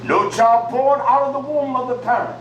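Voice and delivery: ordinary talk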